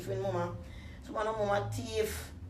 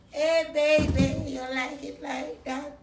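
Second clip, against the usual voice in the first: loud, slow, higher croon; stretched loud croon; loud teasing call; 6 semitones higher, 6 dB louder, slower than usual. Retold or imitated. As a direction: call it out slowly to tease.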